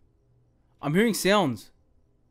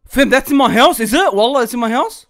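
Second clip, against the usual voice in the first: loud, higher alarmed questions; alarmed shouting; 4 semitones higher, 10 dB louder than usual. Real, not acted. Gamer startled by a noise in the house, shouting questions. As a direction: react loud to a sudden noise.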